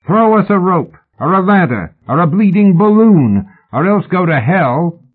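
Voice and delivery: high shouted bluster; scowling shout. A narrator's reading. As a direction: build a list of demands into a curse. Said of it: "Mallory shouted, scowling up at the man".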